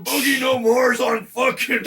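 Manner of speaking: loud laughing troll rant. Acted. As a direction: yell it like a gleeful troll. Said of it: "Your troll voice is fucking perfect".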